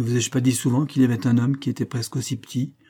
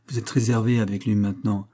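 plain reading voice

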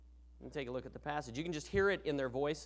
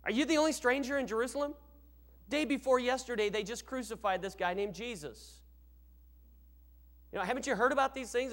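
quick, higher mocking disbelief; incredulous sarcasm, pitched up and rushed; sarcastic; 6 semitones higher than usual. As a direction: scoff at him as if he should know. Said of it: "he's got this sarcasm in his voice".

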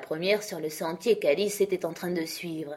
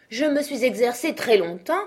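earnest and raised, insisting; very serious; 6 semitones higher, 5 dB louder than usual. A narrator's reading. Knight insisting to Alice he has practised: serious. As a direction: insist gravely on your practice. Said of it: "d'un ton extrêmement sérieux".

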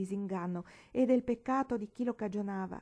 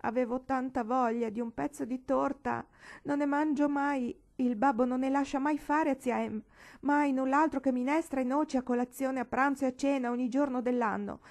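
higher, louder plaintive confession; pleading girl; 5 semitones higher, 5 dB louder than usual. Acted. Paola confessing to Marigold why she stole the cake, plaintive. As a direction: justify yourself, near tears.

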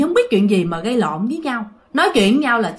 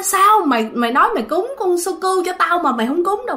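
ghost women's taunt; 7 semitones higher than usual. Retold or imitated. Ghost women demanding why grandpa never made the offering, eerie taunt. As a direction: demand why he broke his word. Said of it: "Đó là nói".

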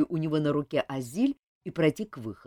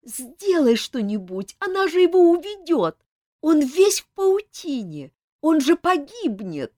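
panicked pleading; 9 semitones higher, 6 dB louder than usual. A narrator's reading. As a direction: plead in terror for his life. Azil begging frantically.

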